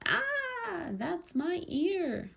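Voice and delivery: very high storybook "ah", then drawn-out words; high acted yelp of pain, slowly wailed; yelling in pain. A narrator's reading. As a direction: squeal it like a child being yanked. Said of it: "Allie yelled".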